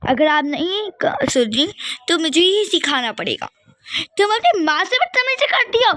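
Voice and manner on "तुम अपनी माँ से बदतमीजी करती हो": higher, sharp angry rebuke; angry scolding. Acted. Mother scolding her rude daughter, angry and high. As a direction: snap at her for her cheek.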